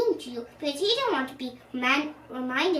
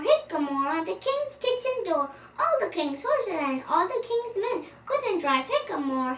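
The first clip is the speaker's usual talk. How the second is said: impertinent taunt; 7 semitones higher than usual. Acted. Nutkin cheekily taunting Old Brown with a rhyme. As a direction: tease the old owl. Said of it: "Nutkin became more and more impertinent".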